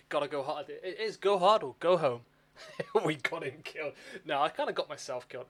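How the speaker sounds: normal talking voice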